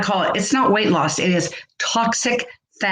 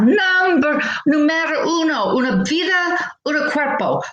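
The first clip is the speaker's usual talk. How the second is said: excited; 7 semitones higher than usual. Acted. Hyped motivational Spanish flourish.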